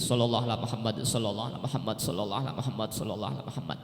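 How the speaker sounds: low, even devotional repetition; hushed, low-pitched repeated blessing; sholawat recitation. Shown as a demonstration. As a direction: recite it softly and steadily, like counting prayers. Demonstrates reciting the short sholawat.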